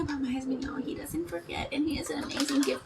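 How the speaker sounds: soft unvoiced whisper; whispered confidence about husband's gifts; whispered aside. Real, not acted.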